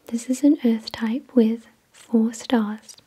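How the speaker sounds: ordinary talk, nothing marked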